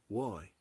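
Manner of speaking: "[name]" said as "Woy"; "[name]" softened to start on W; wrong pronunciation. Retold or imitated. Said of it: "my name is not pronounced".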